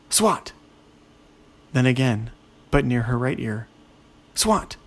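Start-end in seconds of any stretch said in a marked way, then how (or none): 0.1-0.5 short, high-pitched exclaimed action word; sudden exclamation. A narrator's reading. Narrator voices a swat by the ear, sudden exclamation. Ogre swats at whizzing noise. 4.3-4.8 short, high-pitched exclaimed action word; sudden exclamation. A narrator's reading. Narrator voices a swat by the ear, sudden exclamation.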